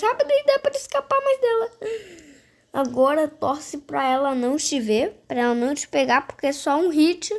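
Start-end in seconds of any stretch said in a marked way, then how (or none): 0.0-1.7 high, fast excited explaining. Real, not acted. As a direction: rattle it off eagerly. Excitedly explains to girl character.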